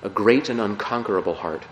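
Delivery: quiet devotional recitation; prayer. Acted. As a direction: pray it gently, pausing between lines. Reads a prayer aloud.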